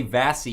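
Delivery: "Vassy" as two syllables, VASS-ee; wrong pronunciation. Shown as a demonstration. Host jokes about not very smart speakers, "Vassy" in two syllables. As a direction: turn vase into "Vassy". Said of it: "you're not very smart".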